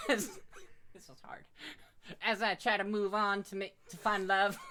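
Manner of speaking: ordinary delivery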